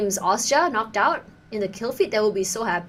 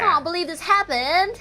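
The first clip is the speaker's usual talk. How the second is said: put-on light Brooklyn accent, slightly raised pitch; a hint of Brooklyn; 6 semitones higher than usual. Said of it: "I was trying to do my Brooklyn accent".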